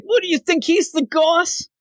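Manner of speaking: high, slow, indignant puppet voice with a laugh; dummy Scarface voice. Retold or imitated. Scarface insists to the men that he is the boss. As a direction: drag out the indignation of a tiny boss.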